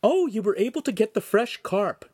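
put-on high-pitched, lightly lifted girl's line; bright-spirited girl, pitched well up; Shamira, pleased girl. A narrator's reading. Shamira to the master about the fresh carp, pleased and high.

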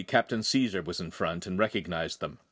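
usual speaking voice

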